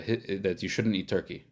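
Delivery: ordinary delivery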